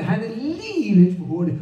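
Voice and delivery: ordinary delivery, nothing marked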